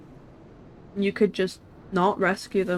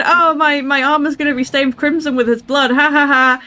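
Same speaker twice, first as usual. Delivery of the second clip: higher, louder mock hero boast; mocking impression; 6 semitones higher, 7 dB louder than usual. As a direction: ham up his smug boast. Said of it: "remember when he said".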